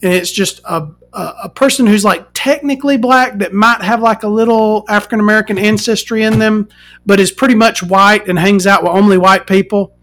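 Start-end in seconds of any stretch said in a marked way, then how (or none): none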